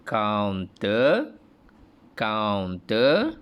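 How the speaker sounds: ulang "counter" dengan nada menaik; review repetition. Shown as a demonstration. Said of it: "Cikgu ulang balik".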